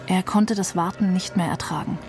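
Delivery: usual speaking voice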